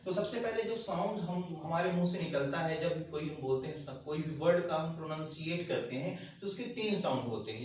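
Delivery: normal talking voice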